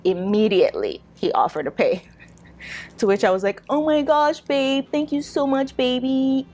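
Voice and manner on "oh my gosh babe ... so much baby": sugary thank you. Retold or imitated. Gushing thanks to boyfriend who pays.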